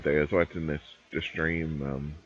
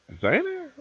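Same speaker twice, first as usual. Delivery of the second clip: laughing. Real, not acted. Laughing at the designers joke.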